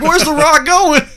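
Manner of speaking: high, loud, drawn-out mock cry; bewildered onlookers. Onlookers watching him run out, high loud mock cry. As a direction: call it out after the runaway wrestler. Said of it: "And they're like".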